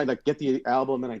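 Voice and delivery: normal talking voice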